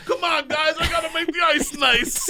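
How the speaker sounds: high laughing shout at the players; annoyed shout. Acted. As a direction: yell at them to clear the ice. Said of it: "a guy on a Zamboni yelling".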